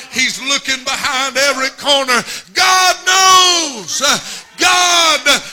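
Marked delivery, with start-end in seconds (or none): 2.5-5.4 loud high shout; screaming. Acted. Father searching every corner, loud high shout. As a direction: call it out behind every corner.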